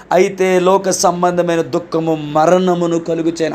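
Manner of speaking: slow, solemn reading of the verse; scripture reading. Acted. Pastor reads Second Corinthians to the church, slow solemn reading. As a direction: read each word of the verse slowly, with weight.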